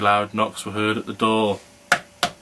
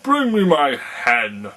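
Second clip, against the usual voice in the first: slow, louder booming command; giant's shout; 4 semitones higher, 6 dB louder, slower than usual. A narrator's reading. Giant shouts to his wife, slow booming command. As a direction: bellow the order down the table. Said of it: "he shouted to his wife".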